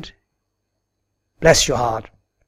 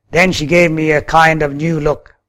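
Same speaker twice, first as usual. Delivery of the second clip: ordinary delivery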